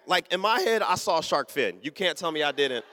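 usual speaking voice

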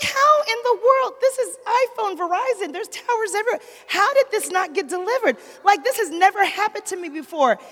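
high, loud incredulous rant; imitating her own baffled outrage; retold disbelief. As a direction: act out shocked frustration at the phone. Retelling disbelief at failed text.

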